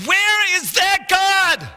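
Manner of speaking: high, loud, slow desperate cry; shouting to God. Retold or imitated. Preacher reenacting his prayer, shouting slowly. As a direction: stretch out the cry to heaven. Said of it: "I'm saying".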